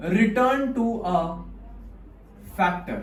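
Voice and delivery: each word spaced out with long pauses; slow term reveal. Shown as a demonstration. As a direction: unveil the term slowly, word by word. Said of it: "इसको अपन लोग प्यार से पता है क्या बोलते हैं?". (धीरे-धीरे, रुक-रुक कर शब्द बताना)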